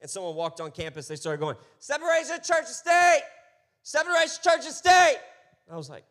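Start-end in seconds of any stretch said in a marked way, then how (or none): 1.8-5.2 much higher and louder, screaming the slogan; mock protester yell. Protester screaming at church volunteers, high furious yell. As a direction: bellow it like an outraged protester.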